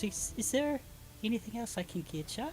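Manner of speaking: baker character. Acted. Baker checking on her customers, lightly voiced and lilting.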